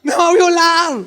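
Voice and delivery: high, shrill-pitched mock cry of accusation; mock accusing scream. Preacher voicing Potiphar's wife accusing Joseph, high mock cry. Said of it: "comenzó a gritar la señora".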